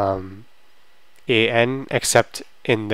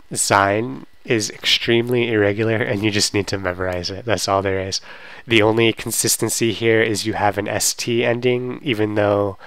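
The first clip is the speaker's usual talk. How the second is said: ordinary talk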